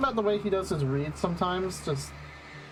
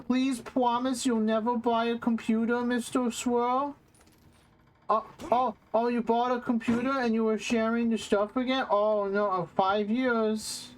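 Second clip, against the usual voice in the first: higher-pitched, childish mocking voice; mock simpering officials; 5 semitones higher than usual. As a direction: play the gullible authorities, pleading in a raised pitch. Mock quote of lenient government.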